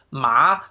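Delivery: "Ma" climbing like a question; rising second tone. Shown as a demonstration. Teacher showing learners the second tone, "Ma" rising. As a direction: lift the pitch through "Ma". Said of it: "It's the rising tone".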